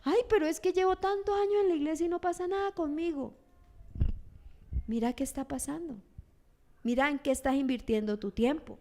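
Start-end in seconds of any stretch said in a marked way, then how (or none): 0.0-3.4 higher, fast whining lament; lightly acted whiny self-pity; whiny complaint. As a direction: complain like a frustrated churchgoer. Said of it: "tú dices".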